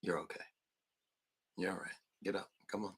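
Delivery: soft, steady calm; calm reassurance. Shown as a demonstration. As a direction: settle the child quietly. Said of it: "you just look at that child and you say".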